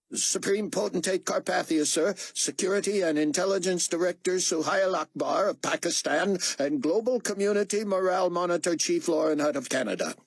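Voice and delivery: high, ceremonious herald's announcement; formal announcement. Acted. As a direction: announce dignitaries to a ruler, pitched well up. Moon formally announces the arrivals.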